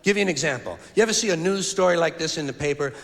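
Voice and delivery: ordinary talk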